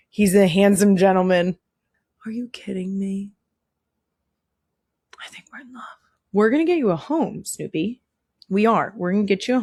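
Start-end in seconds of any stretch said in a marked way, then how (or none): 2.2-5.8 breathless hushed whisper; awed whisper. Real, not acted. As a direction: whisper it as if you can't believe it. Whispering in awe at the dog.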